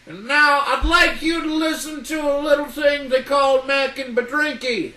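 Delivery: announcer. Acted. Announcing next act.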